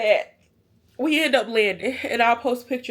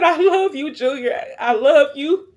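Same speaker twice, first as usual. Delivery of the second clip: high, louder, choked-up last words; tearful declaration; 3 semitones higher, 5 dB louder than usual. Retold or imitated. Mother to her son before the crash lands, tearful declaration. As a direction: cry out love as if for the last time.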